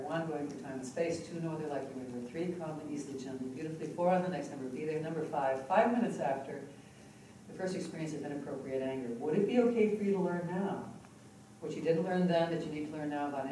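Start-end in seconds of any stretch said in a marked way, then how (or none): 0.1-9.1 soft, even hypnotist count; hypnotic counting. Acted. Hypnotist guiding a client back to their first anger, soft and even. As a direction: lull them back in time, gently.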